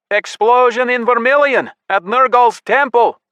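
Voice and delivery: high, loud alarm shout. A narrator's reading. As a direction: yell the alarm across camp. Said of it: "Yari shouted".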